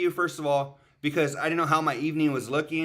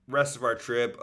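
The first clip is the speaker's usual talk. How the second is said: ordinary talk, nothing marked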